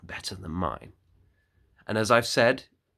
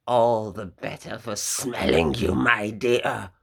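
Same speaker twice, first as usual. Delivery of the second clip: louder, sly put-on wolf voice; 6 dB louder than usual. Wolf answers the girl about his nose, louder sly wolf voice. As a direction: let the disguise slip a little.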